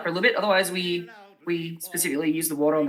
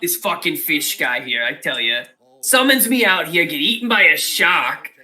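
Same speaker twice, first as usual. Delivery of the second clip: put-on louder, grumbling New York cabbie accent; New York taxi driver; 3 semitones lower, 5 dB louder than usual. Octopus complaining about his summoner, loud New York cabbie accent. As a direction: rant about the boss in a Noo Yawk cabbie voice. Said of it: "New York taxi driver accent".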